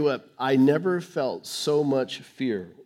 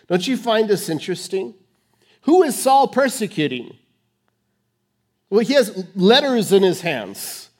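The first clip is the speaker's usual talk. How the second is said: usual speaking voice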